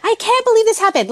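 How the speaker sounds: high, fast, mock panicked wail; mock freak-out. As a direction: act out losing it over the mess. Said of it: "literally screaming and you're like".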